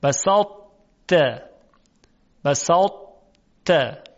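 بساطت slow, thick ط held into the t; partial merger demo. Shown as a demonstration. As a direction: recite بساطت slowly, keep the ط heavy. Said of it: "ادغام ناقص هست".

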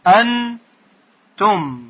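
«انتم» very slowly as «ان», pause, «تم»; high held «ان», then separate falling «تم» in «انتم»; syllable by syllable. Shown as a demonstration. Teacher showing the two parts of «انتم», syllable by syllable.

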